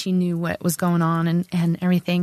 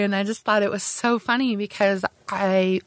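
ordinary talk, nothing marked

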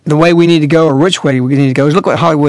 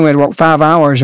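ordinary delivery

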